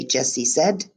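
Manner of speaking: ordinary delivery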